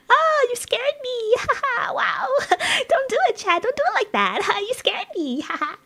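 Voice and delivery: very high, hammed-up fright with laughter; mock startled. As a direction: squeal in pretend shock, giggling. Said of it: "I'll be like".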